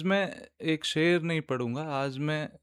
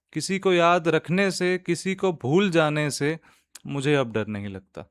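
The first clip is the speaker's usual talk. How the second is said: nazm recitation; 4 semitones higher, 5 dB louder than usual. Acted. Host reciting a nazm to podcast listeners, measured poetic recitation. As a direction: recite line by line, lifting the verses and letting the refrain fall.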